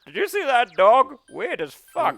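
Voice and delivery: high, loud amazed voice; excited high voice. Real, not acted. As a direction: squeal it in amazement. Amazed at the strange dog.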